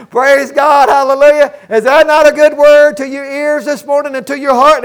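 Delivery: rejoicing aloud at the good word; joyful exclamation. Real, not acted. Preacher exults at scripture.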